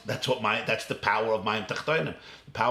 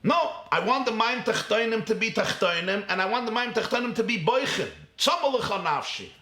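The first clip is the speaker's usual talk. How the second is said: higher, yearning insistence; passionate reply; 8 semitones higher than usual. Acted. Preacher answering Korach, high yearning insistence. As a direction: plead for both worlds at once.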